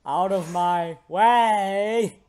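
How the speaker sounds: high, loud, drawn-out order; hammed-up battle yell; acted fury. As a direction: shout it slowly in character. Voicing character in a fight.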